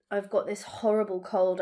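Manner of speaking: normal talking voice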